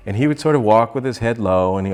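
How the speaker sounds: normal talking voice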